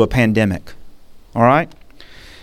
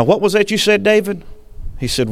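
ordinary talk, nothing marked

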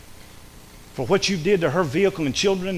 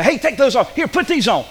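higher, louder brisk command; God interrupting; 7 semitones higher, 4 dB louder than usual. Acted. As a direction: call out like God stepping in. God voiced stopping Adam and Eve.

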